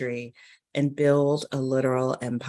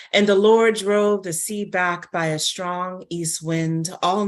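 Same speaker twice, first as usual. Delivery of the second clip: raised, solemn Bible reading, higher and louder; scripture reading; 4 semitones higher, 4 dB louder than usual. Acted. Reading Exodus aloud to open the story, solemn proclaiming. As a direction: proclaim it like a preacher at the pulpit.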